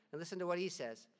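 ordinary delivery

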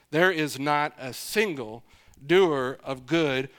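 slow, weighty stress on "single" and "doer"; very emphatic. Shown as a demonstration. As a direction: spell out "not a single doer of good" word by word. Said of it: "Very emphatic, very emphatic".

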